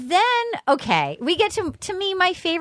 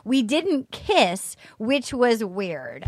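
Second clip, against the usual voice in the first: slow drawn-out quote; 2 semitones lower, slower than usual. Retold or imitated. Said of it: "and she goes".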